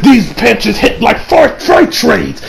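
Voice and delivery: slow, strained put-on voice; Vegeta's strained line. Reviewer voicing a battered Vegeta, slow and strained. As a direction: drag the words out, hurting. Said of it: "He's like".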